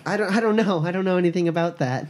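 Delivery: put-on high girlish voice, hesitant start; rescued girl. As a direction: plead ignorance in a high feminine voice.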